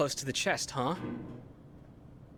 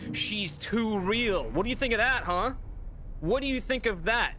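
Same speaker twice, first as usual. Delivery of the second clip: mocking taunt; 8 semitones higher, 3 dB louder than usual. Real, not acted. Taunting the game character.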